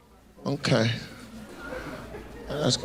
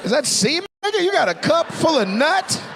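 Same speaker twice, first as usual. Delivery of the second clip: disgusted disbelief; 11 semitones higher than usual. Real, not acted. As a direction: recoil at the cup he's holding. Mock disgust at his drink.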